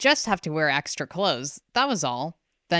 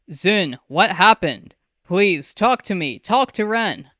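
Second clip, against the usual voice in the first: pleading father; 4 semitones higher, 7 dB louder than usual. A narrator's reading. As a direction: beg her to speak.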